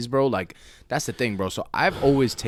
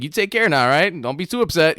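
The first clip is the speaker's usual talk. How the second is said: teasing farewell; 6 semitones higher, 5 dB louder than usual. Acted. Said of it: "I'll be like".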